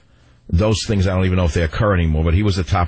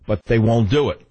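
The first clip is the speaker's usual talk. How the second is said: usual speaking voice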